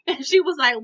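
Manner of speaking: ordinary talk, nothing marked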